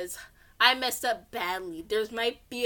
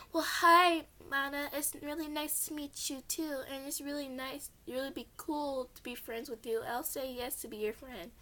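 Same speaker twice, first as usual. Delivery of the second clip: put-on much softer, shy girl voice; shy reply; 4 semitones higher, 6 dB quieter than usual. Shy new girl accepts Mana's friendship, soft. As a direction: answer shyly and quietly.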